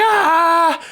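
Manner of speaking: high-pitched mock scream, held out; long, high "aah" of a retold scream; imitated scream. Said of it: "kinda screaming".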